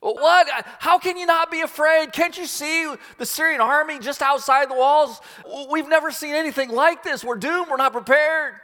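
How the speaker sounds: high-pitched frantic alarm; panicked protest. Acted. Terrified servant argues with the calm prophet, panicked.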